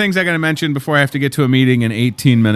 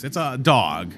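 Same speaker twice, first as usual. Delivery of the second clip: normal talking voice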